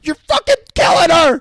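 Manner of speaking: high, loud cry of alarm; hammed-up teacher yelling in alarm; mock panicked yell.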